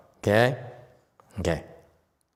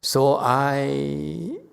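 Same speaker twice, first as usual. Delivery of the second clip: mock excuse; 4 semitones higher, slower than usual. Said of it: "Don't say that".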